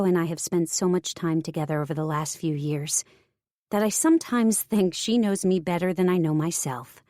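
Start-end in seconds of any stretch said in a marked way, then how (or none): none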